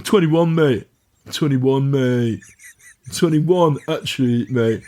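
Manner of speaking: lightly imitated posh lad acting street; mock-posh youth, insistent; posh street persona. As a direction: protest like a posh student playing streetwise. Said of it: "in a posh voice and".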